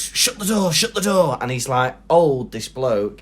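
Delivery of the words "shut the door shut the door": high, pleading old man, mock panic. Old man begging the teen to shut the door, high pleading. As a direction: squeak it out like a frightened old man.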